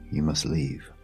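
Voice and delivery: usual speaking voice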